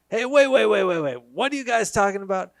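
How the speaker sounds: loud, high, urgent calling out; loud interruption. Retold or imitated. Stranger in line stops them, loud urgent call. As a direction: stop them mid-conversation with a loud call.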